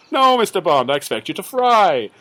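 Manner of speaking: high, loud gloating villain. Retold or imitated. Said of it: "And he goes".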